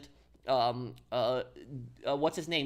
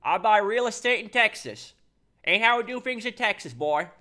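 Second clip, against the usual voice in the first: high, loud Southern impression; Southern accent; 7 semitones higher, 7 dB louder than usual. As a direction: put on a Texan Southern voice, pitched way up. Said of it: "I could kind of do a, a Southern accent".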